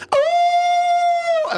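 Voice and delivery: long, very high howl at full volume; wolf howl. Acted. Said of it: "as loud as I can".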